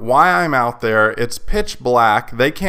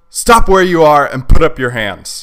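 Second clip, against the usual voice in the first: loud, higher shouted command; retold police yell; 4 semitones higher, 7 dB louder than usual. Officer ordering a night photographer to freeze, harsh yell. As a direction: call out harshly from a distance. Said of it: "somebody yells from me".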